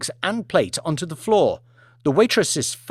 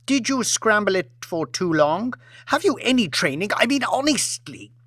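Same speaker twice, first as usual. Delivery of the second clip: haughty scolding; 8 semitones higher than usual. Acted. Lady keeps scolding.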